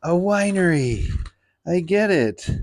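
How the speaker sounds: excited realization. Retold or imitated. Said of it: "And then I was like".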